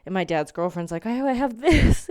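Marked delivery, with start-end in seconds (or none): 1.1-2.1 high, loud, drawn-out imitation; hammed-up enthusiasm; mock eager offer. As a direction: pipe up loudly as the helpful girlfriend. Imitates dad's girlfriend offering headset.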